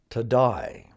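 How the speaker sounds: Australian "to die" for today; Australian pronunciation. Shown as a demonstration. Teacher repeats the Australian friends' version, Australian "to die". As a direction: give today an Australian "eye" vowel, like "to die". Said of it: "friends who are from Australia".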